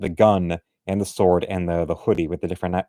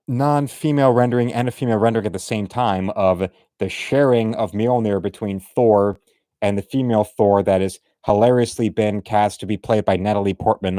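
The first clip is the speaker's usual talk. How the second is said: normal talking voice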